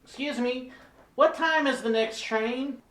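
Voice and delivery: high-pitched clueless tourist voice; dumb tourist. Acted. Tourist asking train worker about schedule, high clueless voice. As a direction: ask it high and naive. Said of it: "some examples of some of the dumb questions".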